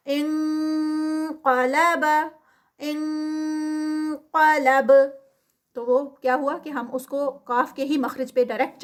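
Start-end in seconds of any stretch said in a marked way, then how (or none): 0.1-2.1 انقلب with the نون as ng going straight to ق; nasal ng recitation. Shown as a demonstration. Teacher demonstrates the ng sound, slow. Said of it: "تو یہ ان کی sound آئے گی". 2.8-4.9 انقلب with the نون as ng going straight to ق; nasal ng recitation. Shown as a demonstration. Teacher demonstrates the ng sound, slow. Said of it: "تو یہ ان کی sound آئے گی".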